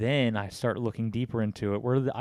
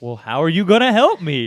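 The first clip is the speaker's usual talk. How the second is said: louder, higher feigned curiosity; mock innocent question; 4 semitones higher, 13 dB louder than usual. Baiting the scammer on the phone, mock innocent question. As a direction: play dumb and loud. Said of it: "And I was like".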